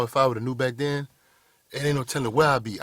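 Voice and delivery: ordinary talk, nothing marked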